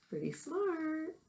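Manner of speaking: slow, high, soft impressed remark; admiring aside. Real, not acted. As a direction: marvel at his cleverness.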